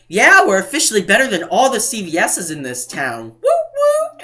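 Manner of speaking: mock cheer ending in high, loud whoops; boast, then whoop it up; imitated celebration. Cashier boasting to his supervisor, imitated celebration.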